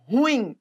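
"ruim" run together as RÚIM, stressed on the u; mispronounced "ruim", RÚ-im; wrong one-syllable stress. Shown as a demonstration. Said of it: "acham que a sílaba tônica é no rum".

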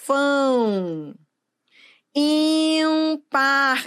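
"Órfão" with a long ÓR, "Ímpar" with a long ÍM; slow sustained opening on "Órfão" and "Ímpar"; exaggerated stressed syllable. Shown as a demonstration. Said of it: "isso é uma questão de pronúncia".

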